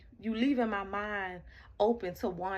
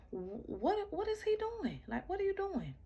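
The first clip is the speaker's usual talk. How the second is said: confused; 11 semitones higher than usual. Retold or imitated. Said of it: "the car like".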